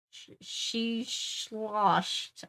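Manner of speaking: very slow, slurred "she sloshed"; slurred drunk. Acted. Mocking slurred drunk speech.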